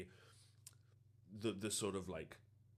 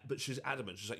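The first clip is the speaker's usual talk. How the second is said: ordinary talk, nothing marked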